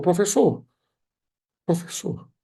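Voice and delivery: high-pitched retold cry to stop the teacher; calling out. Lunch owner interrupts the teacher's punishment, calling out high. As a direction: call out urgently, voice jumping up high. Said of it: "levantou as mãos e gritou".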